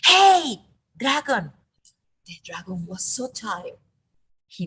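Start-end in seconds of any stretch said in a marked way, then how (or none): 0.1-1.5 much louder, higher yell into the dragon's ear; shouting as loud as she can. Acted. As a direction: bellow to wake a sleeping dragon. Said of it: "She shouted as loud as she could".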